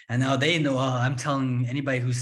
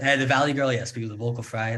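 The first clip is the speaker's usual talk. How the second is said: usual speaking voice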